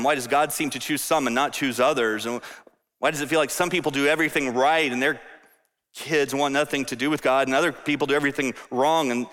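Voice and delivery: ordinary talk, nothing marked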